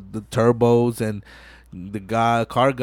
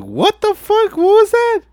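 indignant Mia; 5 dB louder than usual. Retold or imitated. Mia retold again, outraged.

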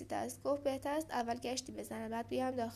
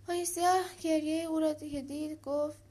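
ordinary delivery, nothing marked

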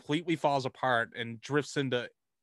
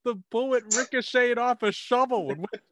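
high, lightly raised fake-innocent voice; mock excuse; 9 semitones higher than usual. Cop explaining the shooting, high fake-innocent voice. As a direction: play a cop excusing himself.

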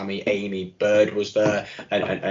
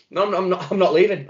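raised, higher-pitched mock refusal; retold protest; 9 semitones higher than usual. Imitating drunk Gibbo refusing to leave the party, insistent. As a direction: imitate a mate digging in, pitched up.

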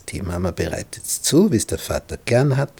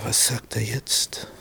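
normal talking voice

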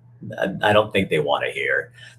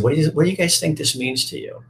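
put-on higher, fast, sing-song for children; teacher voice to kids; 9 semitones higher, faster than usual. He asks the kindergarten class, higher and fast. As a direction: ask it like a teacher to little kids.

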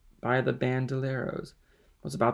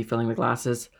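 plain reading voice

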